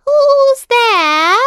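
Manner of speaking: put-on very high, loud old-lady call; grandmother. A narrator's reading. Grandmother calls from her bed.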